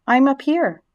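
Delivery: high little call; small voice. A narrator's reading. Unseen spiderling calls down to Wilbur, small high voice. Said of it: "he heard a small voice".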